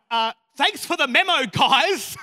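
loud, awkward mock gratitude; mock thanks. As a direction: thank them sarcastically, laughing it off. Sarcastic reaction to daunting speaker lineup.

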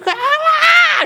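squealing daughter, sudden high shriek; excited shriek. Retold or imitated. Retelling his daughter's reaction to the Disneyland surprise, high thrilled squeal. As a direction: let out a high, loud squeal of delight. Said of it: "and she goes".

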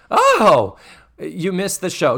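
high, loud theatrical exclamation; broad Missus Garrett. Acted. Missus Garrett on the phone with Joe, broad overacting. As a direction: overplay the dismay, high and loud. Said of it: "she's getting really broad with this".

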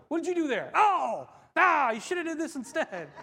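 high, loud mock exasperation; film-session heckling. Watching game film back, mock exasperated heckling. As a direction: react to the botched play, half laughing.

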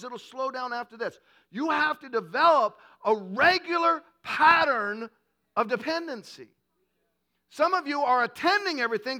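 loud, driving preacher's voice; forceful preaching. Acted. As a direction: preach it hard to the room.

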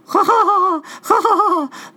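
high, loud cawing laugh; Raven cackle. Acted. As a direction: cackle like a trickster bird. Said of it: "laughed Raven".

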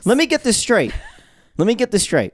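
high-pitched imitation of a baffled questioner; retold incredulity. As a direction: act out someone struggling to believe it. Imitating people baffled by the job.

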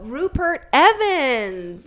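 Rupert Evans said very slowly, reading it off; 1 slow name. Shown as a demonstration.

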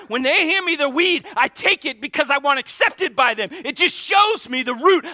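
high, loud fervent preaching; impassioned shouting sermon; full-force preaching. Real, not acted. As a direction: pour it out, pleading with the congregation.

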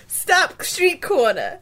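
put-on loud, slow Brooklyn accent trailer voice; lurid grindhouse trailer growl. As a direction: boom it out like a Brooklyn movie trailer. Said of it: "Brooklyn Allison / movie narrator voice".

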